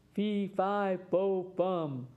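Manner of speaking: lightly voiced giant, mock shout; a hint of a hungry giant's shout. A narrator's reading. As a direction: play the giant calling out, a mild storybook boom. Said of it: "shouted the giant".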